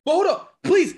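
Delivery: high-pitched disbelief; pushing back, voice way up; incredulous. Real, not acted. Pressing his point in disbelief.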